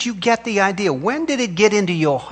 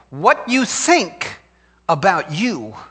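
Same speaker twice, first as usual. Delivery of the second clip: dragged out slowly, every word weighted; slow it right down to drive it home; slow emphatic repeat; much slower than usual. Acted. Preacher repeating his point slowly for the congregation. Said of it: "Let me say that to you again".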